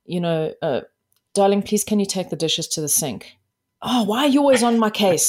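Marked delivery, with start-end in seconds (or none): 3.8-5.3 higher, louder exasperated complaint; triggered outburst. Acted. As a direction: act out a partner taking offence. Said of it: "But you're being triggered".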